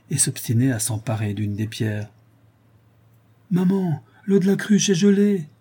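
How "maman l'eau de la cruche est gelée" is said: put-on high-pitched little boy's call; child calling out. A narrator's reading. Boy telling his mother of the frozen jug, calling. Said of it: "cria Félix".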